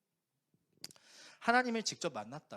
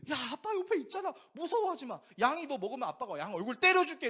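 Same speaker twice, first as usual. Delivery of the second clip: higher, louder shouted reassurance, laughing at the end; father calling out; 10 semitones higher, 8 dB louder than usual. Retold or imitated. Dad shouting to his frightened son at the farm, loud and laughing. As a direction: call over to the boy to calm him, half laughing.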